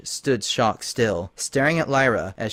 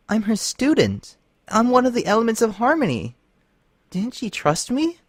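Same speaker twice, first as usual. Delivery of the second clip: hurt pleading; 11 semitones higher than usual. Acted. Twilight hurt.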